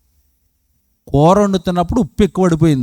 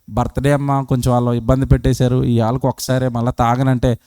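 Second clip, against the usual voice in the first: lower, quick sheepish pleading; sheepish excuse; 10 semitones lower, 3 dB quieter than usual. Retold or imitated. The husband excuses his drinking to his wife, lower and sheepish. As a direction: mumble the excuse like a caught husband.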